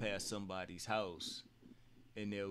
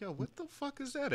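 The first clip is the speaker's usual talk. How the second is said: much higher startled yelp; startled alarm. Retold or imitated. Friends spotting a giant raccoon, much higher startled yelp. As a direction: squeak it out like you just saw a monster.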